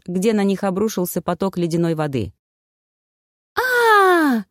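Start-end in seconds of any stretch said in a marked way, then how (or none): 3.5-4.4 high, loud drawn-out "aah"; long high-pitched yell; startled shriek. A narrator's reading. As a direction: shriek as icy water hits you. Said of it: "завопила Таня".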